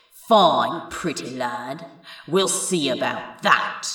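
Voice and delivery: low, sly menace; sly sorcerer. Acted. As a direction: drop into a low taunting threat.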